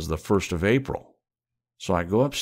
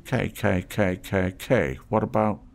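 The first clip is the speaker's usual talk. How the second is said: wheedling trader voice; 3 semitones higher than usual. Acted. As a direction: coax the stranger like a street trader. Reno stalling.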